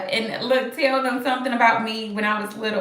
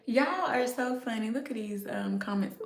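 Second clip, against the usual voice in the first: whisper; 9 dB quieter than usual. Real, not acted. Whispering at the comments.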